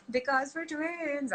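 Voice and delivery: put-on high, long-drawn kid voice; gleeful little niece. Niece answering her favourite question, gleeful kid voice. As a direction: mimic a child stretching out a catchphrase. Niece's catchphrase acted again.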